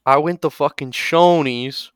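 cocky Boston bro bragging, slowing down; Boston Guido voice. Retold or imitated. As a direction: brag like a drunk Southie bro. Boston Guido brags to group.

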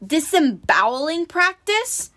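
high, slow rising disbelief; drawn-out startled echo; incredulous question. A narrator's reading. Alarmed query about announcement.

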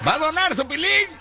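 high calling-out host; hyped fairground barker. Retold or imitated. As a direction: shout to the crowd, high.

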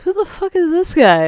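mock alarmed yell. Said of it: "like, like".

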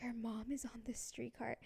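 whispered warning to a friend; hushed, breath-only retold tip-off; secretive whisper. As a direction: whisper it so mom won't hear. Friend secretly warns her in story.

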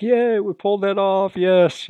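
high, loud cheering as the winning team; hammed-up victory yay; mock celebration. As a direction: cheer the win like you pulled it off. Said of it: "the Alliance teams celebrate".

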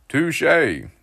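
high, loud conceding dad; amused dad. A narrator's reading. Dad conceding to his son's joke, high and amused. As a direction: give him the point with a smile. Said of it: "smiling back at me".

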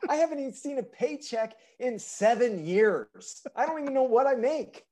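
high, loud, exaggerated whining; mock high whiny voice. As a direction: whine like a henpecked caricature. Continues the mock voice.

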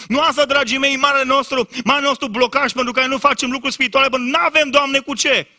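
high, loud, driving sermon voice; fiery preaching. Acted. Preacher urging the congregation, loud and high. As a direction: preach it with rising fire.

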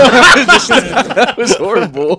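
slow, high words through laughter; laughing. Real, not acted. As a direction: squeeze it out laughing. Laughing at a pet story.

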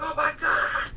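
high, loud mock-horrified cry; imitated frightened shriek. Husband imitating his scared wife, high loud shriek. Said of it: "she's like".

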